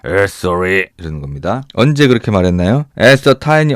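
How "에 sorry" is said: low, soft, grunting mumble; husky grunted apology. Shown as a demonstration. Teacher imitating Mr. Dursley bumping into someone, grunted. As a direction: grumble it like a husky-voiced man. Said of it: "목소리가 걸걸한 사람들 있죠".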